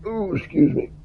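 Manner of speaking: strained high ooh mid-stretch; stretching groan. Real, not acted. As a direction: groan through a back stretch. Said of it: "I gotta stretch".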